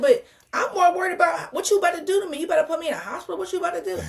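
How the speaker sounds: high, urgent retold protest; worked-up challenge, acted out; retold alarmed demand. Retells challenging doctor in alarm.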